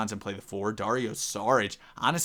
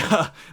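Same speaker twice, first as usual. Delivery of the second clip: short laugh; 8 semitones higher, 7 dB louder than usual. Real, not acted. Laughs after advice rant.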